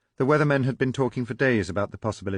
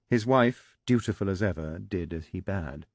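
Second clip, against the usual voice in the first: usual speaking voice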